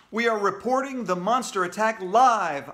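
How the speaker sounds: shouting into mic. A narrator's reading. AJ reporting the attack live, shouting. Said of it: "I shouted into the mic".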